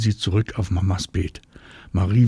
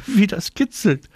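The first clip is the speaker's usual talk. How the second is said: high, ticklish squeal of words; giggly, much higher child's exclamation; 11 semitones higher, 3 dB louder than usual. A narrator's reading. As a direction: pipe up high as if being tickled. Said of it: "Anna muss kichern".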